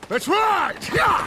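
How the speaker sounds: big high rallying cry; rallying shout. Acted. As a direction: whip the riders into a charge. Rallying riders to charge.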